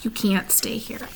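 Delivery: faint dying whisper. A narrator's reading. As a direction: barely breathe the words. Charlotte weak, dying.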